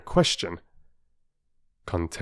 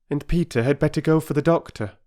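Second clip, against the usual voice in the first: mother voice; 8 semitones higher, faster than usual. A narrator's reading.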